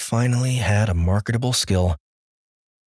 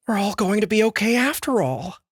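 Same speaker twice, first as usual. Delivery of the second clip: relieved woman; 12 semitones higher than usual. Acted.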